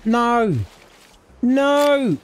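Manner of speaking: panicked no. Real, not acted. Shark attacking the swimmer.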